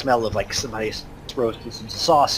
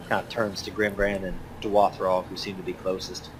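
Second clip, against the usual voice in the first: ordinary talk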